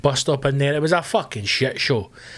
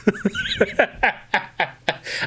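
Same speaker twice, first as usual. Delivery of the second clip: real laughter; 7 semitones higher than usual. Real, not acted. Laughing at own story.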